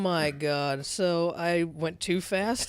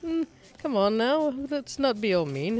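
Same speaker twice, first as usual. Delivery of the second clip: higher-pitched, playful Doctor's gentle scolding; mock Doctor Who; 9 semitones higher than usual. Doctor Who calming his rival, higher mock chiding. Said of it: "Doctor Who will be like".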